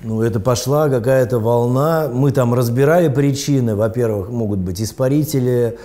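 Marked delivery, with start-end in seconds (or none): none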